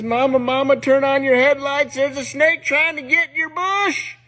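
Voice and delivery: high-pitched mock panic; freaking-out child. Boy wakes from nightmare, runs to parents, panicking. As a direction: cry out to mama in acted alarm. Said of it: "he's freaking out".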